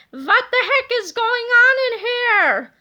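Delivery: high, loud, slow put-on angry voice; gruff angry yell. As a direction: bellow like a cranky old principal. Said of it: "yelled the angry-looking hero".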